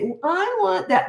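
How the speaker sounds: high, drawn-out whine; whining. Shown as a demonstration. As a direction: whine it like a small child, stretched and high. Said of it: "and this is whining".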